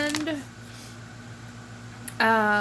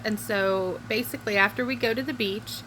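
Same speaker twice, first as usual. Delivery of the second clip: usual speaking voice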